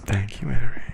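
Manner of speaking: loud voiced thanks. Shown as a demonstration. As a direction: call out the thanks.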